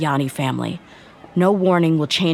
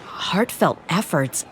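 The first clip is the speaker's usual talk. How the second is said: slow, quiet, stunned echo of the words; stunned disbelief; 5 dB quieter, slower than usual. Acted. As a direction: repeat it as if the ground just shifted. Stunned echo of father's efforts.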